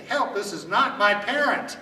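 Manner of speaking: loud call stressing not my parent; yelling for help. Shown as a demonstration. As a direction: shout it so strangers step in. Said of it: "Just say".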